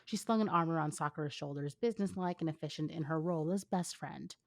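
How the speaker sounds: usual speaking voice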